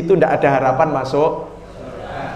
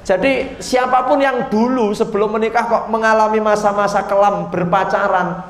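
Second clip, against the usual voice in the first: ordinary talk, nothing marked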